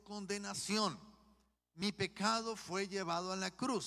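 high, loud, fervent preaching; preaching at full force. Acted. Pastor preaching to the congregation, high and loud. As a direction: proclaim it to the whole church, rising. Preaching climax about belonging to Christ.

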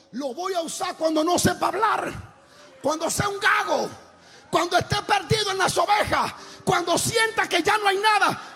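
shouted, sung-out sermon over music; full-force preaching. Acted. Preacher to congregation, shouting and half singing. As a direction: preach it loud and rising to the crowd.

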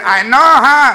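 loud, high butt-in; loud friend. Acted. Friend butts in loudly.

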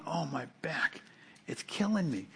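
softly groused, exaggerated backache complaint; mock complaining. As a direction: play up a little ache, quietly. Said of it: "This isn't like me going".

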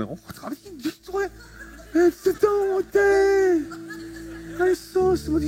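high, slow, sneering mock voice with a laugh; sneering falsetto impression of a church critic; mock snide voice. Mimicking the guy's snide look.

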